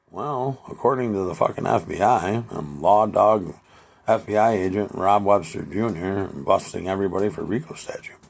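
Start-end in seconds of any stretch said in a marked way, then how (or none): none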